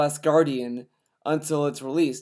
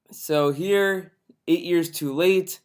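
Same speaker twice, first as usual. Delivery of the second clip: usual speaking voice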